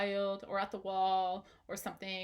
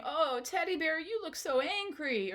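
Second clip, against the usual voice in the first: sympathetic to teddy; 7 semitones higher than usual. Pretend. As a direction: show the child the bear's feelings gently. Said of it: "you just start going".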